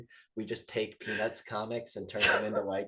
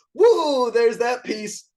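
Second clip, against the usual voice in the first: high, loud hammed-up woo; gleeful whoop; 11 semitones higher, 8 dB louder, much slower than usual. Hero mocking the ball-and-chain guy, loud gleeful whoop. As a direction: cheer like showing off a trick.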